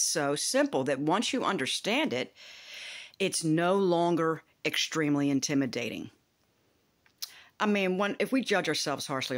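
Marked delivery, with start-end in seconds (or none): none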